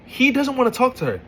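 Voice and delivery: you can't believe this guy; high pitched frustration; exasperated rant. Real, not acted. Ranting about the pointless argument.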